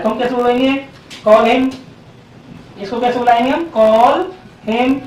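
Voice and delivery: ordinary talk, nothing marked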